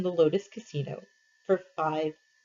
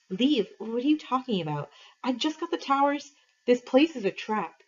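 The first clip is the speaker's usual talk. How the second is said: raised pitch, impatient; brushing him off, higher voice; higher, irritated girl voice; 6 semitones higher than usual. A narrator's reading.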